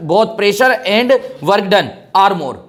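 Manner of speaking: very slow read of the option. Shown as a demonstration. Teacher slowly reads an answer option aloud. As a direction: stretch out every word.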